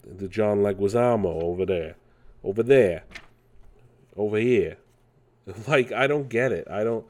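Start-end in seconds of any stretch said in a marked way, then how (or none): 1.4-4.8 put-on New York accent. Riffing on the nineties Mario movie, New York accent. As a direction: drop the r's, New York style. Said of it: "Why does he sound like".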